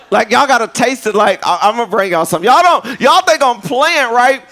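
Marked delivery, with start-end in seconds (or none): 2.5-4.4 emphatic insistence. Real, not acted. Insisting to the congregation.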